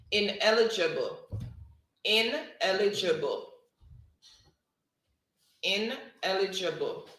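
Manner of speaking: "ineligible" said clearly and loud, each syllable falling to the end; dictated spelling word. Shown as a demonstration. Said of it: "word number three is".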